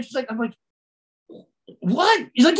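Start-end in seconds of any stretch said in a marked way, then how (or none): 1.8-2.3 high, stunned "what"; surprised. Retold or imitated. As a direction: react in shock. Shocked reaction.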